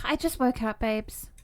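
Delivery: normal talking voice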